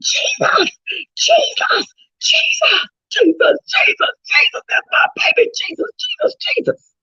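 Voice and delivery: high anguished crying scream of Jesus, breaking into a whisper; retelling a scream of grief, sobbing out Jesus. Retold or imitated. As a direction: wail the name as if you just saw your lost child. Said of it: "I started screaming".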